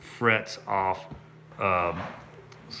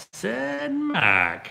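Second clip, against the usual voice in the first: high, loud sing-song "Said Mike"; excited greeting; 4 dB louder than usual. Real, not acted. Streamer greeting a donating viewer, excited and high. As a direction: greet the viewer with a sudden lift.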